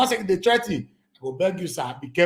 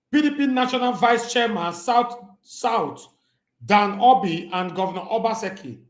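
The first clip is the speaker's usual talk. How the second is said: lightly formal news-reading voice; 4 semitones higher than usual. Acted. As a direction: read the headline like a newscaster, slowed down. Reads news article aloud.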